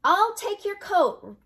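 high, loud, drawn-out offer; singsong welcome. Acted. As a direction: call it out high and slow, as a hostess to a guest. Old woman welcomes supposed rabbi.

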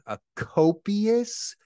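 high, very slow disbelief; incredulous. Real, not acted. Mocking the troll's word, slow and incredulous. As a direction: stretch the word in mock disbelief.

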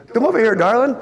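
high, loud folksy Texas greeting; imitating the President's friendly Texas accent; Texas drawl imitation. President greeting the astronaut's wife in a Texas accent. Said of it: "and a nice little Texas accent".

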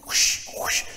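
unvoiced hissed whooshes; breathy whoosh of a tail between the legs; slinking whoosh. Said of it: "That's the sound of my tail between my legs".

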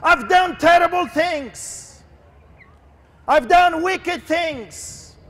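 high, drawn-out cry of repentance; anguished pleading. Acted. Sinner on their knees begs Jesus for mercy, anguished pleading. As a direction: wail it as a desperate confession. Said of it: "go to your knees and say".

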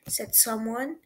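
ordinary delivery, nothing marked

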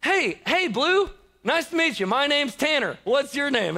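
put-on high, sing-song kid introducing himself; little kid voice. Ten-year-old player greeting the umpire, high kid voice. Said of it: "this little ten-year-old kid".

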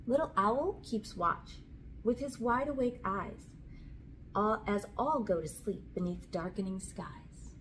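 plain reading voice

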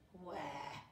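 low, soft, scraping rasp; granite-scraping voice. A narrator's reading. Looming sailor demanding the girl, low scraping voice. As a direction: grind it out like wet stone on stone. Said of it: "voice that sounds like two slabs of wet granite scraping".

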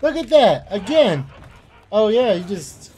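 high, loud cursing; angry outburst. Real, not acted. Player cursing a repeat attack, angry outburst. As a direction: snap at the enemy, exasperated. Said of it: "I feel myself getting angry".

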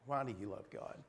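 normal talking voice

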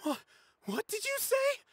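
sputter, then shoot up high, stung; indignant shock. Acted. Student stung by Alhaitham's insult, high indignant gasp. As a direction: pitch jumps way up in offended disbelief.

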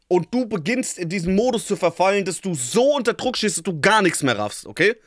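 laut und höher, mit Nachdruck erklären; aufgedrehte Rechtfertigung. Real, not acted. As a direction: dich unter Druck vor den Zuschauern rechtfertigen. Aufgeregte Rechtfertigung vor den Zuschauern. (laut, aufgebracht erklärend)

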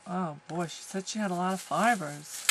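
ordinary talk, nothing marked

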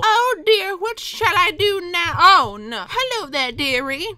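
put-on high, fussy little old lady voice; high-pitched put-on old lady. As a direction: coo it high like a worried granny. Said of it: "a very happy old lady".

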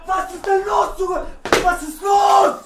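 high, loud goal screams; explosive celebration; jubilant shouting. Real, not acted. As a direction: yell it in excited triumph. Trailing player celebrates his goal.

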